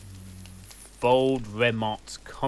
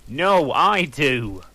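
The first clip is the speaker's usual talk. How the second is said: louder, slow defiant shout; 4 semitones higher, 8 dB louder, slower than usual. A narrator's reading. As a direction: insist loudly, drawn out.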